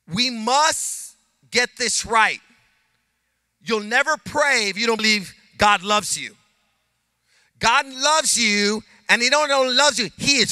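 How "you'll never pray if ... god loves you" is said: ordinary delivery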